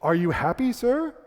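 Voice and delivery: lightly voiced polite waiter; solicitous waiter. Waiter leaning over a stuffed diner, polite rising question. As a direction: question lifting high on SIR. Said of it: "the guy leans over, he says".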